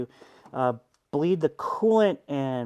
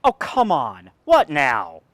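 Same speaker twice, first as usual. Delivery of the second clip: loud frustrated groan; fed-up outburst at the car; exasperated; 10 dB louder, slower than usual. Real, not acted. Car fails again.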